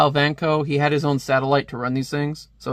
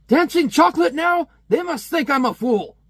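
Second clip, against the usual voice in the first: much higher, loud indignant outburst; irate stepfather; 13 semitones higher, 4 dB louder than usual. Acted. Stepfather raging at a chocolate ad, high indignant shout. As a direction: rail at the TV like it insulted you. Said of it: "he is just irate".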